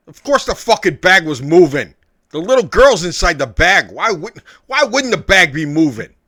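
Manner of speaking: loud, exasperated NPC rant; angry outburst. Acted. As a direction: snap back, fed up and loud. GM voices angry NPC reply.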